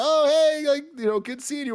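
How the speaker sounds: high, forced cheerful brush-off; nervous bright cover-up; fake friendly. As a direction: bluff like you know him. Fakes recognizing a forgotten man.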